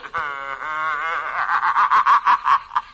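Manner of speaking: long, loud maniacal cackle; haunted-house cackle; evil laugh. Acted. Spooky voice laughs at its threat.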